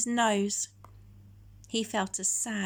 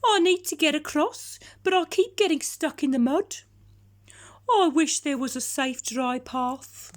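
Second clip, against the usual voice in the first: howling dog; 6 semitones higher, 6 dB louder than usual. Acted. Dog howling, stuck in bog.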